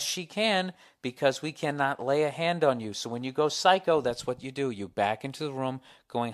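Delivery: ordinary delivery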